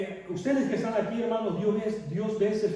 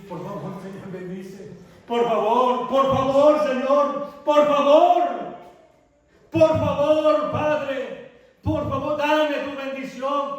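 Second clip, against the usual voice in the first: Jacob pleading; 6 semitones higher, 5 dB louder, slower than usual. Acted. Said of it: "y rogando". (Jacob ruega al Señor, de súplica suave a clamor fuerte)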